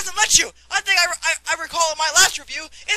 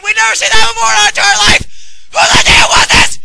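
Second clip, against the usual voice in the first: exasperated yell; 11 dB louder than usual. Acted. Exasperated outburst.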